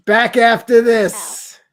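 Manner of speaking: slow, loud, high radio sign-off; announcer voice. Pretend. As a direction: boom it like a show host going to break.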